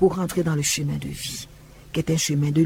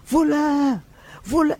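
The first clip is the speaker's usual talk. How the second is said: yelling thief; 10 semitones higher, slower than usual. Retold or imitated. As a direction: shout it like an accusing woman. Woman yelling in church courtyard.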